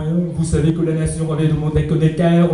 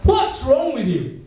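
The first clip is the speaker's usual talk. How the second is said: exasperated Singlish rebuke, pitched up; Singlish complaint; 5 semitones higher than usual. Shown as a demonstration. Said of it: "a little bit of a classic".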